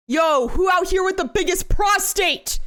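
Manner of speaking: mock hype. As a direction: call out the crowd like a hype man. Mock hype call-out to chat.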